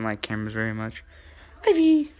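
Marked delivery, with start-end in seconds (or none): 1.7-2.1 loud high call of her name; shout to the dog. Real, not acted. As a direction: yell for the dog across the room. Calling the dog.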